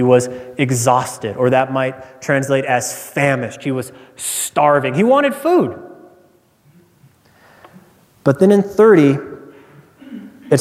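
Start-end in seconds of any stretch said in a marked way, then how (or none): 4.9-5.8 emphatic. Acted. Stressing Esau's starving hunger.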